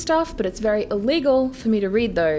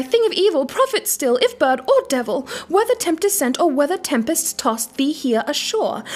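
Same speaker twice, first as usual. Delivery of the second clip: desperate imploring; 8 semitones higher than usual. Acted. Desperate narrator imploring the raven, high and raised. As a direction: beg the demon bird for an answer. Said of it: "said I".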